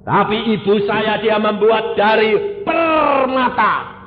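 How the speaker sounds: loud, high, fast sermon cry; fiery preaching. Acted. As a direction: preach it out at full force. Preacher proclaims loudly. (khotbah berapi-api dengan suara keras)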